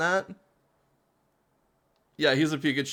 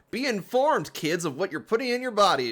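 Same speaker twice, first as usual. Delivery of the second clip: high preachy public service announcer; mock PSA voice; 6 semitones higher than usual. Mocking his own boring answer as a high PSA voice. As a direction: lecture the kids like a cheesy ad.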